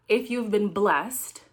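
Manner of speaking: slow, deliberate weight on "blessed"; stress on BLESSED. Real, not acted. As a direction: lean hard on "blessed", drawing the phrase out. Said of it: "emphasis on blessed".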